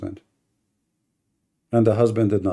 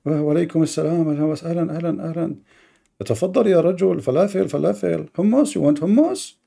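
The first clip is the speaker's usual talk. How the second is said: high, fast, fawning welcome; mock meek host; 8 semitones higher than usual. Husband fawning over the intruder, high and fast. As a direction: ham up an eager host offering food. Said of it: "he says, uh".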